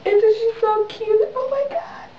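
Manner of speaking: very high-pitched cooing; gushing adoration. Real, not acted. Gushing over newborn's picture.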